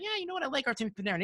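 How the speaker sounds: caving in with a shrug in the voice; mock giving in. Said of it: "So I'm like".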